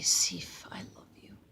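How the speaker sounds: whispered softly to his wife; loving whisper. Acted. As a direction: breathe it to her like a secret.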